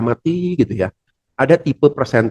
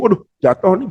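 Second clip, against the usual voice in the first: tense fluster; 6 semitones higher than usual. Retold or imitated. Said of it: "jangan bolpennya jatuh terus kita tegang".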